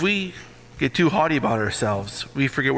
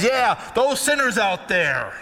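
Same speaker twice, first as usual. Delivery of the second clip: mocking self-righteous voice, pitched up; hammed-up holier-than-thou; smug church people; 8 semitones higher than usual. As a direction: lampoon the smug churchgoer, high and preening. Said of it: "church people pipe up and says".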